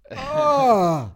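sudden high loud cry; pained cry. Retold or imitated. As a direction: yelp as if blinded. Blinded by bright lamp, retold.

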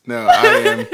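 high, loud squeal of laughter; laughing protest. Real, not acted. Cohost cracking up while denying, laughing squeal. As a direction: blurt it out mid-laugh.

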